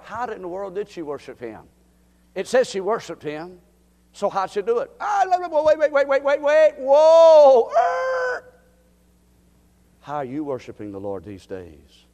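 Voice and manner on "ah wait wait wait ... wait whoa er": sudden high, loud cries of wait and whoa; excited outburst. Real, not acted. Preacher breaking into excited cries to the congregation. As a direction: burst out in rising excitement.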